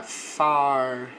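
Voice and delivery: "far" slow with an open, long a; "far" drawn out, open a. Shown as a demonstration. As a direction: stretch "far" with the mouth wide. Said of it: "A boca ela fica mais aberta".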